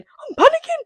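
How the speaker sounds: very high panicked squeal; mock panic. Reader acting out Rowan panicking, mock panic squeal. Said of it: "he's panicking".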